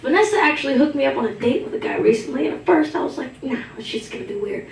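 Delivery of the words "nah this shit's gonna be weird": hushed, doubtful aside under the breath; skeptical mutter. Retold or imitated. Comic recalling her doubt about the date, muttered. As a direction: mutter it like you already regret agreeing.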